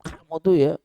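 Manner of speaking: high-pitched scolding burst; parent snapping. Acted. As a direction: act out a parent telling off a teenager. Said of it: "kita bentak". (membentak anak dengan nada tinggi)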